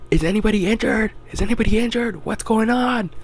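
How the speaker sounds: a boss anxiously shouting on a walkie-talkie; worried foreman. Retold or imitated. Foreman calling over walkie-talkie.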